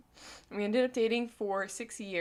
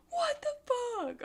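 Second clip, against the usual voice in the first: squeaky disbelief; slower than usual. Real, not acted. Laughs at her red face.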